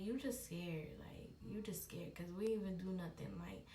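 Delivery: low soft whispering; calm her down in a hush. Retold or imitated. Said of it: "He was like".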